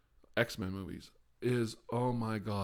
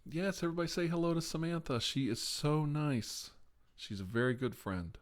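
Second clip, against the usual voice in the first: 1 sing-song greeting; 7 semitones higher than usual. Real, not acted. Greeting a friend in chat, sing-song.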